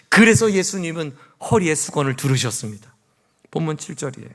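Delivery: ordinary talk, nothing marked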